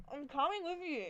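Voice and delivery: high, aggrieved giving in; upset surrender. Acted. Tagger submitting to the police, distressed and resentful. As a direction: protest while reluctantly complying.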